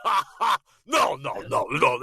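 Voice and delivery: hammed-up star's distinctive holler; Tom Hanks. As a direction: burst of laugh-yell into repeated protests. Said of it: "Tom Hanks".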